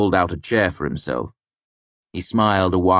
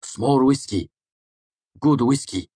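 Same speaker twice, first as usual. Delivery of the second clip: higher, lightly voiced innkeeper woman; higher clipped tavern woman; barmaid voice; 8 semitones higher than usual. A narrator's reading. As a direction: voice the barmaid, brisk.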